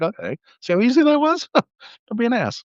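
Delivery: high, teasing told-you-so; mock scolding. Scolding the customer afterward.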